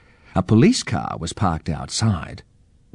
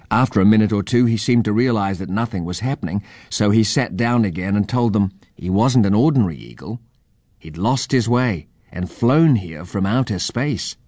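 ordinary delivery

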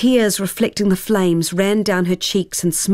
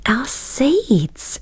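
excited whisper; 7 semitones higher than usual. Acted. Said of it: "exclaimed Tara".

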